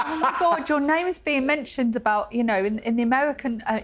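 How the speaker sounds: mock-excited, lifted voice passing on big news; excited prison girls. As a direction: retell it as the girls' thrilled gushing. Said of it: "were so excited. They were like".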